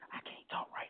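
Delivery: unvoiced whispered line; whispered I can't talk right now. Shown as a demonstration. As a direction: breathe it without voice as if hiding. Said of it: "Why did you whisper?".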